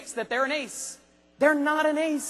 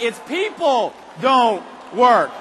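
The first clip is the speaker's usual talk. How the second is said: emphatic slow shout; 7 dB louder, much slower than usual. Real, not acted. Preacher's climax, drawn out.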